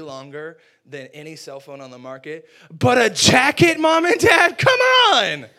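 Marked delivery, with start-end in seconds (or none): 2.7-5.5 loud protest climbing very high; outraged kid yelling at parents, hammed-up; indignant shout. Acted outburst over jacket gift.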